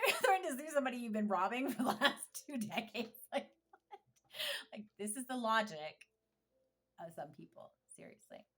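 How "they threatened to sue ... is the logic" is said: loud, high, laughing disbelief; real incredulous laughing. Real, not acted. Laughing at parents threatening to sue, loud real disbelief. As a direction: burst out loudly through laughter.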